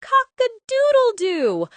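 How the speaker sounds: long high loud cock-a-doodle-doo; loud rooster crow. Acted. The loudest sound of all.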